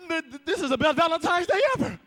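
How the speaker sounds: fast, high, stammering delight; breathless stuttering joy; starstruck stammer. Acted. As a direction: babble like a starstruck fan.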